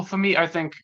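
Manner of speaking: ordinary delivery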